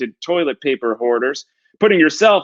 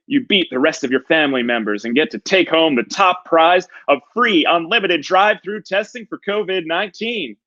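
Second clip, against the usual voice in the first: ordinary talk, nothing marked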